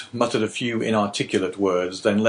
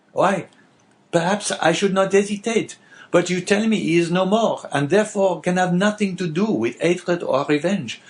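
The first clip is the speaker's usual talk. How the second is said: lightly voiced innkeeper, a touch higher; a man talking himself out of it; Caderousse's voice; 9 semitones higher, 3 dB louder than usual. Innkeeper explaining to the abbé why to stay silent, lightly voiced.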